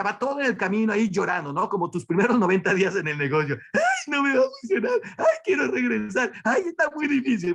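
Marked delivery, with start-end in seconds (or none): 3.7-7.5 high, fast mock whimper; hammed-up whining complaint; whiny newcomer. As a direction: play the scared beginner begging to quit.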